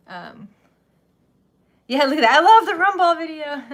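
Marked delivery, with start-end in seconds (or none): 1.9-3.6 higher and louder, gushing; light up over the video; real delight at seeing the Rumball video; excited. Real, not acted.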